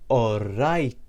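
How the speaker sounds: All right drawn out slowly, all... right; slow demonstration. Shown as a demonstration. Teacher repeats it for learners, slowly drawn-out All right. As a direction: stretch both syllables of All right.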